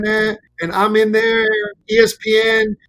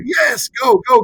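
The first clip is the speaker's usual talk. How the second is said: excited yes; 4 semitones higher than usual. Retold or imitated. Jordan-fan wife urging husband to go, excited. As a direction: push him out the door with glee.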